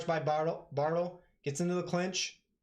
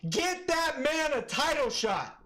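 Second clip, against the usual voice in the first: higher, slowed, stretched hype call; more hyped repeat; 12 semitones higher, slower than usual. Real, not acted. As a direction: drag it out, even more fired up.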